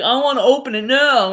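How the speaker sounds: loud mock whine for it; impatient demand. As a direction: insist loudly like an impatient kid. Acts out T's impatient demand.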